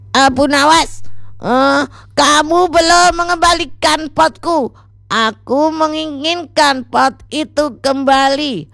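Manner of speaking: tokoh penagih bersuara tinggi; high-pitched, insistent demand for something back; Sajid. A narrator's reading. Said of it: "katanya si Sajid". (suara tinggi menagih)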